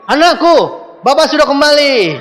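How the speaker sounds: a mock-heroic father proclaiming over the rain. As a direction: play the triumphant dad sheltering his kids. Father returns in raincoat heroically.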